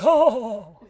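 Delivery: high swooning Oh with a laugh; high airy oh. Retold or imitated. Pretty-boy Thor sighing, high swooning oh. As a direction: sigh it out like a dreamy long-haired Thor. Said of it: "I don't know why I said it like that".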